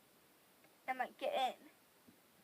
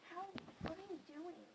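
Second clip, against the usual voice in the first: soft, higher-pitched wary question; hushed questioning; 4 semitones higher, 9 dB quieter than usual. Retold or imitated. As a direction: ask it under your breath, pitched up. Night-time questioning of sister, retold.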